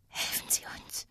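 thin, distant whispered plea. A narrator's reading. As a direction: whisper faintly for help, as if from far away. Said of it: "flüsterte auf einmal eine Stimme, dünn". (dünn und wie von weit weg geflüstert)

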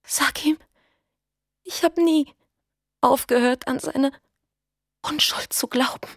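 high, barely audible plea fading to a whisper; faint pleading. A narrator's reading. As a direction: beg with fading breath. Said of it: "flehte Elena kaum hörbar". (kaum hörbares Flehen)

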